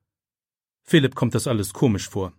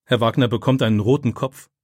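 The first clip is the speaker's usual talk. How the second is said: ordinary delivery, nothing marked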